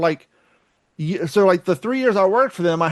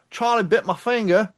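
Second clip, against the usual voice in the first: put-on Australian accent; 4 semitones higher than usual. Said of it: "See, I went Australian".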